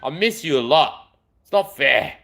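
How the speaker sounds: ordinary talk, nothing marked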